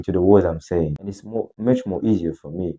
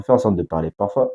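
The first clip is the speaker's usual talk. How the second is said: usual speaking voice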